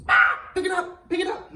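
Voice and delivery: usual speaking voice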